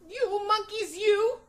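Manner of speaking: high loud scolding; scolding peddler. A narrator's reading. Peddler scolding the cap-stealing monkeys, high and loud. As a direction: scold them with a wagging finger. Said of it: "he said, shaking a finger".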